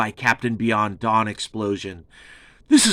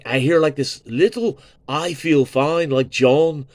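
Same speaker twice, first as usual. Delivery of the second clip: ordinary delivery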